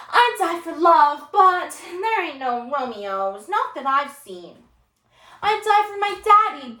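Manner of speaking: slow, stretched-out melodramatic student; loud, high openings sinking into drawn-out complaint; disruptive student. Acted. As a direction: declaim it to disrupt the class. Student's disruptive speech, voiced in play.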